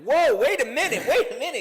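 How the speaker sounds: high yelling Kevin Hart impression; Kevin Hart alarm. Imitating Kevin Hart's alarm.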